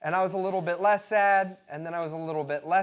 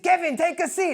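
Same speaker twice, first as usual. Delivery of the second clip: high-pitched mock Belgian accent; Christoph; 10 semitones higher, 4 dB louder than usual. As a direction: call it in a bad Belgian accent. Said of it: "that's not a Belgian accent".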